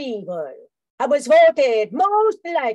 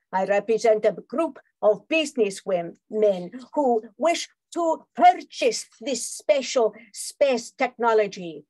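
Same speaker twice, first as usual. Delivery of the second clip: ordinary talk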